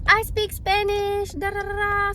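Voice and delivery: high, drawn-out mock boast; slowly singsong it, imitating a drunk girl. Drunk girl bragging she speaks Spanish, mocking singsong.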